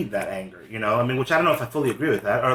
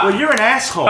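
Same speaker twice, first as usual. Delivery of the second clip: louder, higher mock insult; retold angry insult; 7 semitones higher, 6 dB louder than usual. Woman at the train snaps back at him, imitated angry retort. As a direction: hurl back an insult as the other person.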